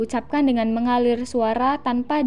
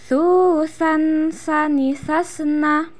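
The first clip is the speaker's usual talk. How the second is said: slow drawn-out recitation drill; swung, held syllables; 3 semitones higher, much slower than usual. Shown as a demonstration. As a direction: swing the vowels, hold the nasals. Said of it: "ayun suaranya".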